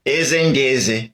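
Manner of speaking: slow Igbo in a lightly imitated English accent. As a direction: lightly mimic an English speaker saying Igbo. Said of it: "speak everything in his own accent".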